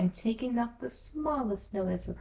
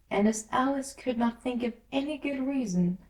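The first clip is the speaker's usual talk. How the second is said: usual speaking voice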